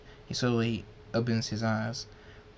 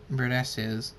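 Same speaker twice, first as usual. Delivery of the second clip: plain reading voice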